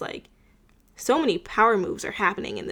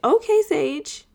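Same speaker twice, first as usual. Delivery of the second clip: Okay, Sage, said higher and louder in a put-on teasing tone; teasing Max; 5 semitones higher, 7 dB louder than usual. Max teasing Sage about flirting with Brooklyn, higher and louder. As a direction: tease her like a friend who noticed the flirting. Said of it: "Max is like".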